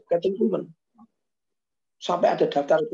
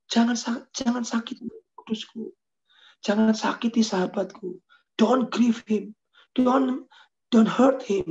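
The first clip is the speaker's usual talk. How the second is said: pleading preacher; 6 semitones higher, slower than usual. Retold or imitated. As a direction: beg the crowd not to grieve the Spirit. Voicing the preacher's plea. (memohon dengan suara tinggi dan keras)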